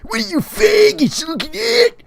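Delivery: loud, high-pitched screamed challenge; hammed-up confrontational shriek; Eric. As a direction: screech at the onlookers. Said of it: "He screams".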